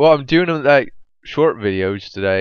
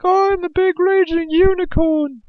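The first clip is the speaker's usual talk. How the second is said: much higher, silly squeaky boss voice; mock boss voice. Mocking the raid boss who drops from the sky, squeaky voice. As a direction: lampoon the boss in a tiny cartoon voice. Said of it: "he's all like".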